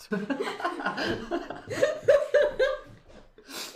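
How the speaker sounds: high, loud laughing fit; real high-pitched laughter. Real, not acted. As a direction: burst out laughing at length. Table laughs at farmer's reply.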